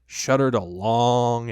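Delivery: usual speaking voice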